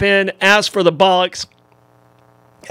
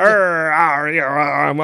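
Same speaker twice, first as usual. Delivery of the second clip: pirate growl; 3 semitones lower, much slower than usual. Pretend. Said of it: "I don't know which language I was talking".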